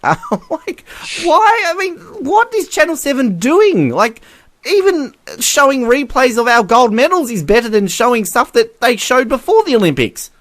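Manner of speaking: high-pitched, baffled rant; incredulous and pitched well up; exasperated disbelief. Real, not acted. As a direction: start from a laugh, then protest high in disbelief. Exasperated at what the channel is showing.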